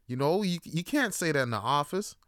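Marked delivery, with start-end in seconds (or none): none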